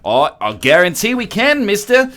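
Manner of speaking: high, sassy reply; smug. Retold or imitated. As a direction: talk down to him smugly. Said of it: "And the lady's like".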